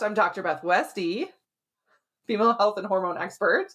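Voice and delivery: normal talking voice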